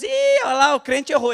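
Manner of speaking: jeering high-pitched call-out, starting loud; mocking onlookers. Onlookers spotting a believer's mistake, mocking high-pitched jeer. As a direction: point and jeer at someone's slip.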